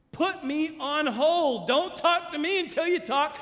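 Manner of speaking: high, loud preaching shout; forceful command. Acted. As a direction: order them away with urgency. Voices Jesus urging reconciliation forcefully.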